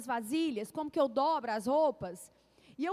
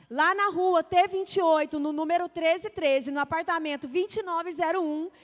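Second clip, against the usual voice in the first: preaching at full force; 6 dB louder than usual. Acted. Pregação em voz forte.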